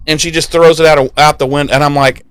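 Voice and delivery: ordinary delivery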